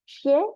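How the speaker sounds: 谢 said xiè, high and falling; fourth tone. Shown as a demonstration. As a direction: punch 谢 down from high. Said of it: "fourth tone".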